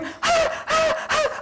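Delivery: very high shrieked ah; hammed-up high scream; mock scream. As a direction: shriek like a grown man terrified of a spider. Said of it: "we're all pointing, going".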